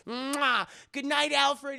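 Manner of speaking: high, loud mock-feminine farewell; hammed-up girlish goodbye; affectionate fiancée. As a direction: blow a kiss and sing out good night. Andrea kisses and bids good night.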